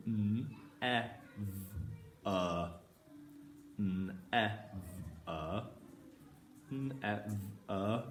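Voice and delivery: slow separate sounds n, e, v, er; sounding out phonemes. Shown as a demonstration. Phonics teacher sounding out for children, slow separate sounds. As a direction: say each sound apart, softly. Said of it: "Our first word will be".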